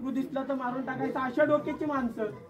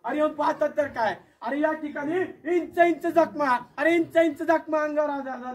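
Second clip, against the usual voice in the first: ordinary delivery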